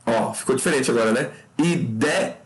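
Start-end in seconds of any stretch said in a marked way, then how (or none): none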